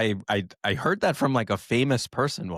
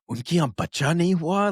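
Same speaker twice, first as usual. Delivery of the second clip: lightly imitated relative, higher-pitched question; gossiping relatives; 7 semitones higher, 3 dB louder than usual. Relatives talking to his parents about no kids, gossiping. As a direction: voice a nosy relative asking, pitched up. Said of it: "extended families started tell-, like, talking to my parents".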